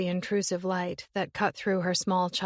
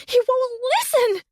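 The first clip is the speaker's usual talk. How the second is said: much higher and louder shriek; hurled shriek; 8 dB louder than usual. Acted. Summer shrieking at her sister in hysteria. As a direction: shriek it out at full force. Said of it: "The last was a hurled shriek".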